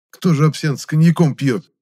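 высокий громкий изумлённый вопрос; спроси, не веря ушам; удивлённо. A narrator's reading. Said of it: "удивился я". (громкое удивление)

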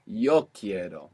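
slow, with yo stressed. Shown as a demonstration. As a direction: lean on Yo in Yo quiero. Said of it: "if you want to be emphatic".

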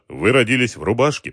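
ordinary delivery, nothing marked

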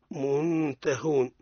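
usual speaking voice

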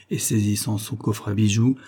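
plain reading voice

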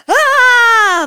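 very high, loud frightened scream. A narrator's reading. The grandmother screams loudly in fright at the wolf news. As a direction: shriek in terror. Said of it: "громко закричала бабушка".

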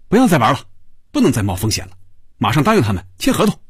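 high, pressed, hurried order; impatient and agitated, speeding up. A narrator's reading. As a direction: cut him off, fed up. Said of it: "托尼不耐烦地摆着手".

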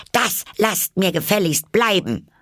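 laut und hoch gerufen; Schrei. A narrator's reading. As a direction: scharf hinausrufen. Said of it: "schrie hinaus". (Alice schreit die Tiere draußen an)

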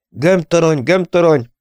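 loud insistent calling; repeated urgent call. A narrator's reading. Pilot calling the silent ball turret, urgent. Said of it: "hívta Dennis egyre".

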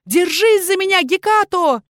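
high, loud shout while falling. Acted. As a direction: yell to the cat as you fall. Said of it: "Я успела выкрикнуть".

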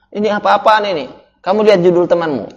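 imitating an annoyed senior telling off a junior; mock angry senior. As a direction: act out the senior berating him over the report title. Senior angrily rejects the report.

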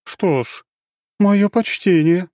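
high, slow respectful nod; mock-solemn respect; impressed goddess.

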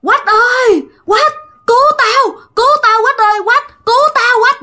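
very high, wailing cry for rescue; imitated screaming for help. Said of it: "nó la làng vậy nè". (giả giọng la làng kêu cứu)

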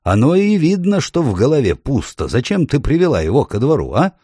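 put-on high, testy old dwarf; irritated dwarf. A narrator's reading. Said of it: "раздражённо переспросил гном". (раздражённое брюзжание гнома)

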